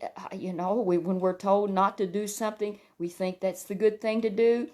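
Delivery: ordinary delivery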